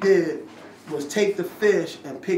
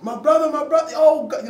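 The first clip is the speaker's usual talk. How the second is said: high, loud, overjoyed calling out; excited street greeting; 12 semitones higher, 8 dB louder than usual. Retold or imitated. Strangers on the street hailing the visitor, high and loud with delight. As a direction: greet a long-lost brother with a burst of joy.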